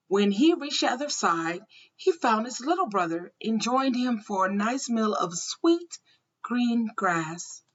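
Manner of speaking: plain reading voice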